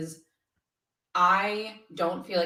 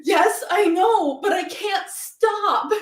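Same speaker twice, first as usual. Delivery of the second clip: high, loud, slow mock wail; imitating a helpless tearful outburst; retold sobbing; 12 semitones higher, 8 dB louder, much slower than usual. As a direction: drag out each word in hammed-up crying. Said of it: "I was sobbing".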